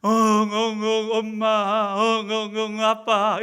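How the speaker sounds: loud, drawn-out pretend bawling; slow, hammed-up sobbing for mom and dad; mock wailing child. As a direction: imitate a crying kid calling for parents. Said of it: "엉엉하고 울고만 있습니다".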